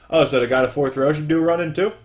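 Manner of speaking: ordinary talk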